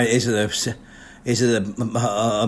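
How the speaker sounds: normal talking voice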